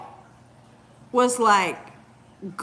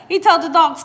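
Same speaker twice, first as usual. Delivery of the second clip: normal talking voice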